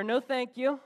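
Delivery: a mild called-out refusal, pitched high; calling out. Retold or imitated. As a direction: call back across the water, half-hearted. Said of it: "he calls out to her".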